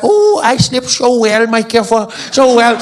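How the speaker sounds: slow, high, loving coo; sweet old wife. Retold or imitated. Old Afrikaans wife answers her husband in bed, loving coo. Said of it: "She's like".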